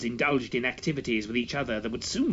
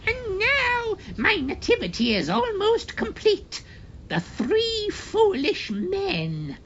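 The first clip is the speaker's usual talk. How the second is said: high, loud witchy hag voice; high cackling crone, slow on the last words; 4 dB louder, slower than usual. A narrator's reading. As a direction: gloat like a triumphant witch.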